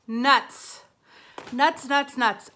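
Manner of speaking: loud exasperated nuts; frustrated outburst. Real, not acted. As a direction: blurt it out, annoyed. Frustrated at forgotten item.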